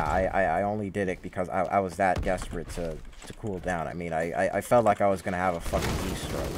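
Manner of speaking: ordinary delivery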